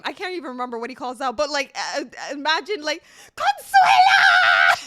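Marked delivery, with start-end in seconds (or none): 3.4-4.8 long, high, loud wail of her name; desperate shout. Retold or imitated. Retelling a reality show, the boyfriend screams for his girlfriend in anguish. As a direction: howl the name across the beach in anguish. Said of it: "he's calling out her name".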